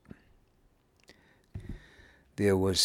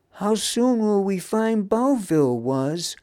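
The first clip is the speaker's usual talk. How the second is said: high, louder impatient demand; pressing question; 12 semitones higher, 6 dB louder than usual. A narrator's reading. Daub pressures Wuz about arrival, impatient. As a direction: press hard for an answer. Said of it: "pressured Daub".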